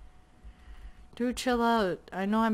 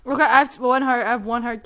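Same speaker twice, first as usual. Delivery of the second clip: panicking gamer; 3 semitones higher, 8 dB louder than usual. Real, not acted. Down to one heart.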